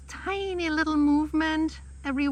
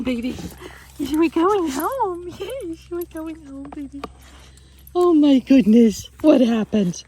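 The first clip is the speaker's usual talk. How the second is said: excited reunion; 5 dB louder than usual. Real, not acted. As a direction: gush over a found dog. Reunion with her dog.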